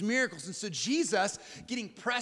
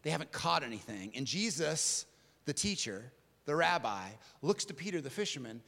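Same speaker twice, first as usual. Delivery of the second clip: ordinary talk, nothing marked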